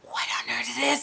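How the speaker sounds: breathless stunned whisper. Real, not acted. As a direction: hush it, awed by the screen. Stunned while watching.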